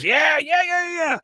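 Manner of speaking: high, excited mock cheer shouted out; acted cheering. Recapper acts out Dale cheering from the stands, high excited yells. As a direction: whoop it up like a fan. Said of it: "what are you cheering for".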